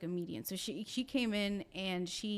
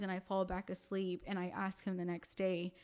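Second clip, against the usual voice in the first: ordinary talk, nothing marked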